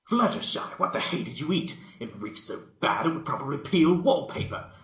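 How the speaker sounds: high, loud, disgusted; retorting. Acted. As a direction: bark it back in shock at the smell. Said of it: "retorted Twilight".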